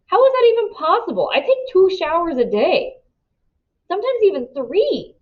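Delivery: high incredulous protest; shocked, defensive; indignant disbelief. A narrator's reading.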